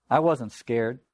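usual speaking voice

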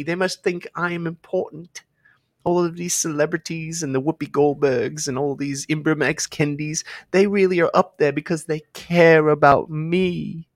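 high, drawn-out mock-naive voice; mocking impression. Host mocks people who trust celebrities, mockingly naive.

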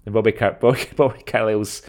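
ordinary talk

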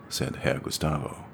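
ordinary delivery, nothing marked

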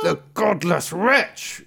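high, loud, slow fury; outraged cry. Acted. Tailor learns his second son lied, loud drawn-out outraged cry. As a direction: draw out the curse in outrage. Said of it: "cried the tailor".

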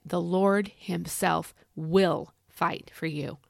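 slow, deliberate phrase with weight on "will"; stress on WILL. Shown as a demonstration. Preacher modelling a promise for listeners, slow emphatic "will". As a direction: set "will" apart, drawn out and weighty. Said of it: "put that extra emphasis on that word will".

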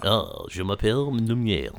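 put-on French voice; playful mock French; French accent. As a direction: introduce yourself as a hammy Frenchman. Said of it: "best French voice on for this".

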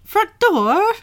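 high, hammed-up British "front door"; British accent. Shown as a demonstration. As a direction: show off how British "door" sounds. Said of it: "kinda British is when they say door".

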